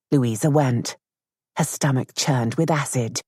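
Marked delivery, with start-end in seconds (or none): none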